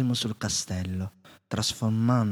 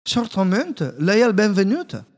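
high, louder welcoming voice; courteous candlestick; 9 semitones higher, 6 dB louder than usual. A narrator's reading. Candlestick welcoming the lost inventor, high and loud. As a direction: greet the guest with bright courtesy. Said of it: "disse una voce".

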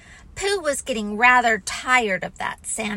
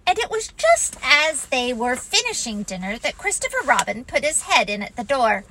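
ordinary delivery

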